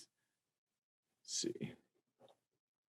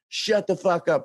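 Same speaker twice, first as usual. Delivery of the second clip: mock answer; 9 semitones higher than usual. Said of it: "And he's like".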